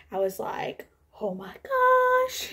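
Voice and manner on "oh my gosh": high, drawn-out airy squeal; flipping out. Retold or imitated. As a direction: stretch it out in stunned, breathy disbelief. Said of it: "I was flipping out".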